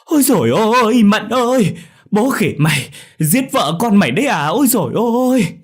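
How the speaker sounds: appalled neighbour bellowing reproach; loud outraged scolding. A narrator's reading. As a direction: holler at him in horror. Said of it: "Tiếng cụ Cẩm chửi đồng lên".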